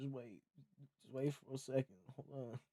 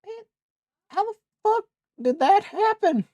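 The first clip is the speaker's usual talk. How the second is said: much higher, louder, baffled cry; disbelieving yell; 14 dB louder than usual. Real, not acted. Streamer baffled by the glitch, high loud disbelieving yell. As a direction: demand an answer from nobody, loud and high.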